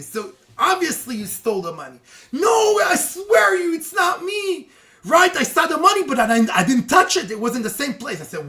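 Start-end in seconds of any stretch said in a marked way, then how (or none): 2.3-8.2 high, loud slowed protest of innocence; desperate denial. Retold or imitated. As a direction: swear he didn't do it, frantic. Accused servant protests his innocence.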